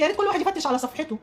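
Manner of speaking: ordinary talk, nothing marked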